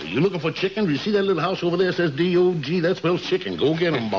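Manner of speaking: high, blustering Southern rooster; big rooster lecturing a young dog; Foghorn Leghorn voice. Acted. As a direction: hold forth like a know-it-all barnyard rooster. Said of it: "he talks like that".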